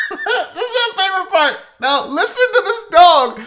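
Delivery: high laughing shout through the words; cracking up; laughing hard. Real, not acted. Laughing hard at the clip replay.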